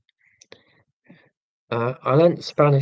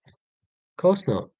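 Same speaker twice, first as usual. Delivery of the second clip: mock sarcastic; 7 semitones higher, much slower than usual. Sarcastic denial after mock.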